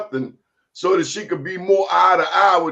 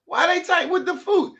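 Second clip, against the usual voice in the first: much higher exasperated question; 12 semitones higher than usual. Real, not acted. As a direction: plead in disbelief.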